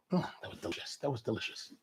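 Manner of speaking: hushed, dreamy appreciation; soft murmur remembering a delicious meal; soft savouring. Real, not acted. Savouring memory of the food.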